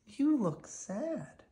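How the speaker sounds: put-on high, slow, worried child voice; gently notice a friend's sadness; concerned children. The twins noticing Woody looks sad, high and slow.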